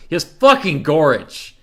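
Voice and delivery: loud, high, punching "fucking"; outraged complaint. Real, not acted. Streamer complaining about the opponent's hero, outraged. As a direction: blurt it out in disbelief.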